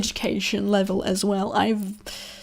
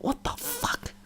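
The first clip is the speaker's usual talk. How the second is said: high, breathy stunned whisper; a kid stunned in class; stunned disbelief; 9 dB quieter, slower than usual. Retold or imitated. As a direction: mutter it in shock. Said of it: "I'm sitting there like".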